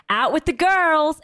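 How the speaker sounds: hammed-up social post; Mama D impression. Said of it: "She's like".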